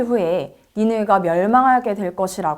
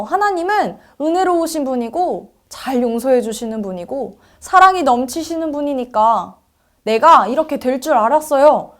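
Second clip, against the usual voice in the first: higher, heated complaint to God; raised-voice indignant outburst; angry; 5 semitones higher, 5 dB louder than usual. A narrator's reading. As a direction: protest hotly, as if you knew it all along. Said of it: "하나님을 향해서 화를 냈어요".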